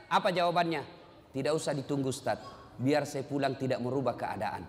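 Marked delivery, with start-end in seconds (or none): 1.3-4.6 low, understated dismissive reply; imitated matter-of-fact indifference; unmoved son. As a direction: play a son coolly declining, pitched down. Rich son coolly declines to come.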